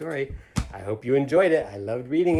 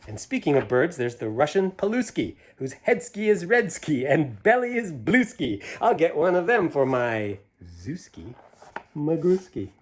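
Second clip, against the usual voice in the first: lively rhyming storybook read; reading to children; 5 semitones higher, 9 dB louder, slower than usual. A narrator's reading. Reading a picture book aloud to children, lively. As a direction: bounce through the rhyme for the kids. Reads Seuss rhyme aloud.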